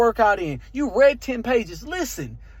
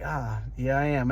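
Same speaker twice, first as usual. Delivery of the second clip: sheepish; 9 semitones lower, 6 dB quieter than usual. Retold or imitated. Voicing his old insecure self.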